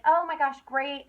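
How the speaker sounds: a bright, loud oh my gosh; excited reply. Retold or imitated. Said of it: "And be like".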